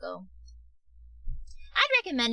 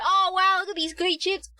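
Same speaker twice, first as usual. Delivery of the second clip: mock taunting; 10 semitones higher, 7 dB louder than usual. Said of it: "be like".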